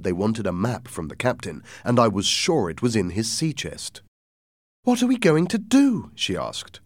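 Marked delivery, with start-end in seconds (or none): 4.8-6.1 high, louder, anxious question; mother at a loss, pitched way up; worried mother. Acted. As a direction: plead for a plan in a high voice. Frightened mother asks for a plan.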